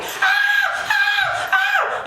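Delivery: high shrieking mock panic scream; hammed-up frightened squeal. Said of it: "she's like".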